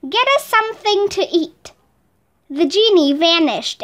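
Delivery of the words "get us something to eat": eager higher-pitched request; crying out. A narrator's reading. Aladdin asks genie for food, crying out. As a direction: call out the wish, pitched up. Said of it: "cried Aladdin".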